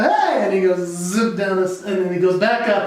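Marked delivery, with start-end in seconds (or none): 0.0-0.4 higher, louder playful hey; cheerful answer. Retold or imitated. Dad answering his son on the slide, bright and high. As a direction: answer your kid with delight. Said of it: "And I go".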